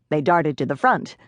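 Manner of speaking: usual speaking voice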